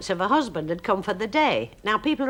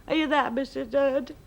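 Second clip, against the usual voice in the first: a plaintive, higher mock-sad call at the door; lightly voiced sad neighbour calling; 8 semitones higher than usual. Neighbour at the door asking for help, mock-sad. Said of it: "a very sad voice said".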